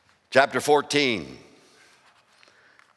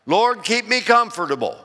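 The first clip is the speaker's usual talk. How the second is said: mock pampered churchgoer, a little higher; whiny churchgoer; 7 semitones higher than usual. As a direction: whine about your comforts. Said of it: "How many of you".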